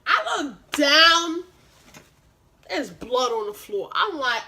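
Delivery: plain reading voice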